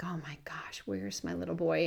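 panicked whisper. Retold or imitated. Father panicking after failed dive.

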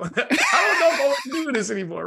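high, laughing cry of cold feet; mock panicky niece. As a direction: imitate a nervous niece backing out. Voicing the nervous niece, laughing.

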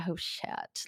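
breathy whispered oh shit, under the breath; whispered dismay. Retold or imitated. Seeing clients pass her, whispered dismay. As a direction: let it slip out as a shocked whisper. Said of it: "I was like".

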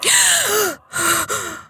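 shocked gasp. Acted. Shock at the writing.

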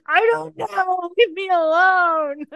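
high, slow, hammed-up protest; mock defensive whine. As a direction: whine back at your own scolding. Acts out answering her own scolding.

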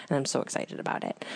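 excited whisper; hushed, eager secret; frantic whisper. Real, not acted. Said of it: "I have to whisper this".

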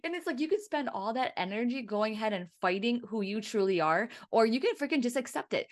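ordinary talk, nothing marked

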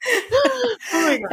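high, drawn-out laughing exclamation. Real, not acted. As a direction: squeal it slowly through real laughter. Cracking up at accent joke.

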